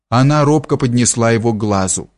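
ordinary delivery

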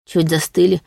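ordinary delivery

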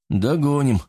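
driver's assured answer, pitch lifted; confident. A narrator's reading. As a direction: assure him with certainty. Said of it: "уверенно сказал шофёр".

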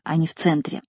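ordinary delivery, nothing marked

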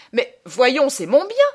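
high, loud objection; exasperated protest. Acted. Traveller protesting to the old mourner, exasperated outcry. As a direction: burst out indignantly.

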